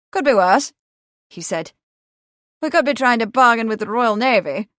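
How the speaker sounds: a hint of hearty gentleman; confident young motorist. A narrator's reading. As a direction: agree out loud, heartily. Milbank shrugs it off.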